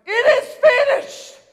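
triumphant full-voiced cry; cry of victory. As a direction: shout it out in triumph. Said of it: "It's a cry of victory".

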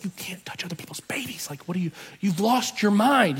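imitated urgent stage whisper; scolding whisper. Mom stopping dad petting a stranger's baby, scolding whisper. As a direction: whisper a horrified reprimand, voice rising in force.

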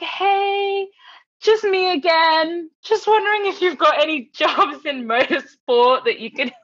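high, loud singsong check-in; hammed-up persistent cheeriness; mock chirpy pestering. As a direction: nag sweetly with exaggerated cheer. Acting out pestering monthly emails.

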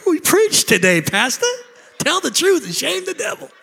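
high, mock church ladies calling out; hammed-up voice of women in the pews. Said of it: "Look at those women going".

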